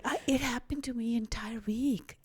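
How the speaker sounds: hushed, breath almost gone; choked whisper. Real, not acted. Telling the host she cried all week, whispered. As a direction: let it fall to a whisper.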